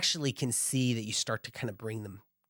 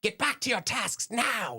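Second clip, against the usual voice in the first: yelling commander; 6 semitones higher than usual. Acted. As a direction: order the troops back to work. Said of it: "He's, like, yelling at them".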